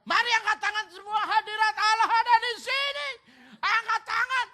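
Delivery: loud, high pushy worship call; loud high parody of a worship leader; mocking song leader. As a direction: overdo the hype of a cocky song leader. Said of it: "Lu baru song leader itu sombongnya".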